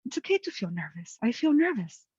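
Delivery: hushed and gentle, then lifting high on nervous; soft reassurance. Shown as a demonstration. Nervous before an appointment, soothing herself quietly. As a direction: say it softly to calm yourself. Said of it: "Or you can choose to say".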